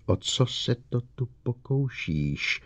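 a restrained cry of disbelief; outcry, not question. A narrator's reading. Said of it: "spíše výkřik".

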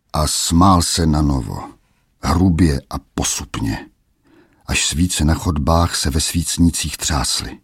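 plain reading voice

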